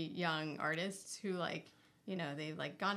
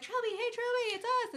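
much higher eager calling voice; friends calling out; 4 dB louder than usual. Retold or imitated. Old friends calling to Trilby in her carriage, high and eager. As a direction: call up to her high and hopeful. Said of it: "they're like".

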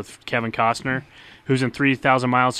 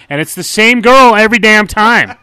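much higher and louder, exasperated; exasperated outburst; 10 semitones higher, 14 dB louder than usual. Real, not acted. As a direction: blurt it out in disbelief. Exasperated rant about movie.

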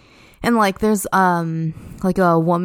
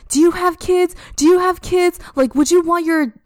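mock angry scream; 8 semitones higher than usual. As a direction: imitate an outraged mother screaming in the park. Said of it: "starts screaming at me".

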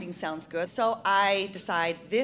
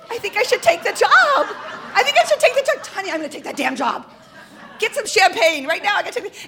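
high, loud outburst of elation; excited shouting; 11 semitones higher, 12 dB louder, faster than usual. Retold or imitated. Wife announcing her big decision to her husband, excited shouting. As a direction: shout it in a hammed-up thrill. Woman decides aloud to take job.

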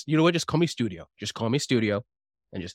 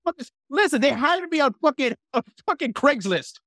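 exasperated high voice; 11 semitones higher than usual. Acted. Player's ninja defends himself.

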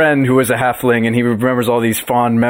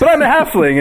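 high, playful halfling plea; mock-innocent, pitched way up; pleading halfling; 13 semitones higher than usual. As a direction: play the cute halfling card. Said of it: "you're just like".

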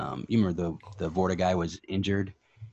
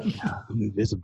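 stoner; 3 dB quieter than usual. Pretend. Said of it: "I'm so stoned right now".

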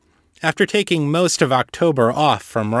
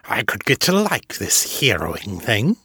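Zeke's voice. A narrator's reading. Zeke voiced.

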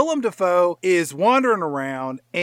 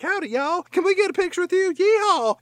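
put-on Texan accent; 13 semitones higher than usual. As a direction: cheer like a Texan tourist, pitched way up. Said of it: "They're from Texas or something".

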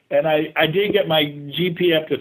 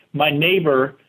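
ordinary delivery

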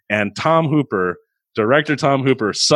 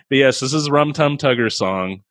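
ordinary talk

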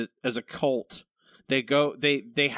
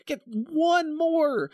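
sarcastic mock reply; 8 semitones higher than usual.

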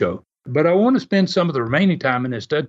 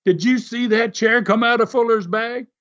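higher-pitched mock outrage; half-hearted retold scream, pitched up; 7 semitones higher than usual. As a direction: imitate an indignant yell without the volume. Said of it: "he screamed to Les".

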